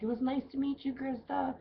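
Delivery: high, light imitation of a celebrity; imitating Jerry. Bartender imitating the celebrity thanking her, high light mimicry. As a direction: lightly mimic his polite goodbye. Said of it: "he was just like".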